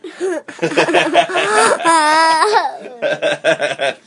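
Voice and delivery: long high-pitched laugh; helpless high laugh at a mistake. Real, not acted. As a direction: burst out laughing and let it run on. Laughs at own spelling mistake.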